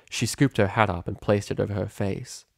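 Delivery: ordinary delivery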